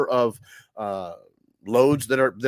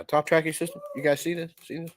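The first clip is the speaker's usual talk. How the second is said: Indian accent impression; 4 semitones higher, 4 dB quieter than usual. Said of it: "that's my Indian impression".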